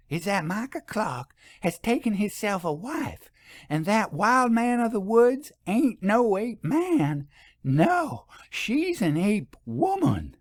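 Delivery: high, twangy gossip revealing a secret; folksy shopkeeper voice. A narrator's reading. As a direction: play a sly rural shopkeeper.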